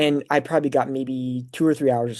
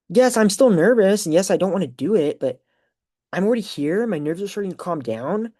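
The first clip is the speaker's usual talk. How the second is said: higher-pitched concession; emphatic self-talk; 6 semitones higher than usual. Retold or imitated. Graduate reasoning with their own nerves, emphatic self-talk.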